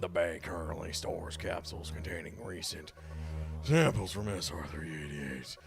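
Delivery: lightly done robot computer voice. Acted. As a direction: read it like a ship's computer. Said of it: "you wanna do the robot voice".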